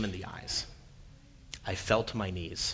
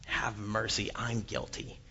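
quiet plea for mercy; 7 dB quieter than usual. Retold or imitated. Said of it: "When we say".

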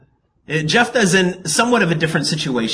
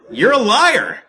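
loud, high mock accusation; playful raised-voice accusing; 10 semitones higher, 6 dB louder, slower than usual. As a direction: call out the liar, half laughing. Acted accusation of the Bostonian.

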